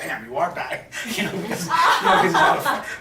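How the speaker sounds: breaking into loud high laughter at the punchline; real laughter while telling the story; laughing. Real, not acted. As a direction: laugh right through the words.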